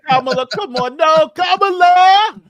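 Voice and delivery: slow, high, loud hammed-up cry of Kamala; mock-mourning shout; mock wail. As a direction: wail her name in fake despair. Mock grief at Kamala clip.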